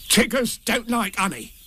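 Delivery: high, emphatic creature verdict; very decided Tigger. A narrator's reading. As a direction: pronounce it firmly in a high voice. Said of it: "in a very decided voice".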